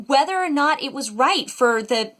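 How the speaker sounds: normal talking voice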